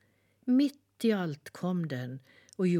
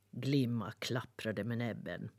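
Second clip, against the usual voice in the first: plain reading voice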